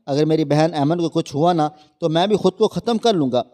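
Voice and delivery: ordinary delivery, nothing marked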